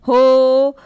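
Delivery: loud call to someone far off. Shown as a demonstration. Teacher showing how to call a distant person, loud हो. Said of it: "जैसे हम किसी को पुकारते हैं".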